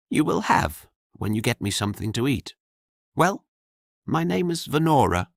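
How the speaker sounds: a hint of a bold young maid, playful; young woman voiced. The narrator voices the girl bantering with Jorian. Girl's voice in dialogue.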